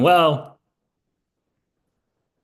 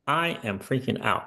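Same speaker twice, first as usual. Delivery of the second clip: ordinary talk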